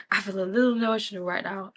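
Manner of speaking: admitting she's moved, half laughing; emotional, a touch teary. Real, not acted.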